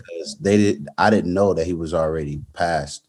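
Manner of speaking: soft, low, sombre voice; subdued. Real, not acted. Fighter reveals he had not known his father died, subdued. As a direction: say it quietly and low, weighed down.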